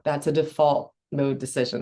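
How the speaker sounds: ordinary talk, nothing marked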